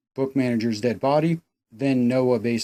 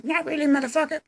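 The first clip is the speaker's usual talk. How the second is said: high squeaky falsetto retort; spring the betrayal in parody falsetto; mock defiant woman; faster than usual. Reviewer voices Emma turning on the killer, mock-defiant falsetto. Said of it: "she's like".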